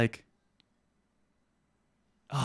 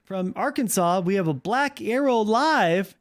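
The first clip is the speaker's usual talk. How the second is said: hammed-up host, pitched up, stretching the caller's name; call-in show host; 6 semitones higher than usual. Streamer plays host introducing a caller, hammed-up announcer. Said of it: "And I'm like".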